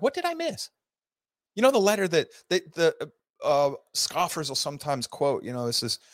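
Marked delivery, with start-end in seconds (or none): 0.0-0.7 much higher, incredulous "What did I miss?"; baffled self-question. Retold or imitated. Puzzling over a historian's claim about Adams, baffled and high. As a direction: ask it as if truly stumped. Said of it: "I'm like".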